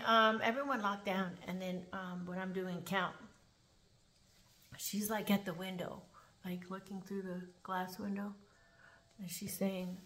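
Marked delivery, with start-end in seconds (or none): none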